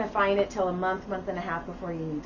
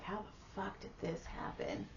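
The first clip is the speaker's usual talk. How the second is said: imagined quiet disbelief; 2 semitones lower, 19 dB quieter than usual. Retold or imitated. Husband and wife on a porch looking at each other, softly amazed.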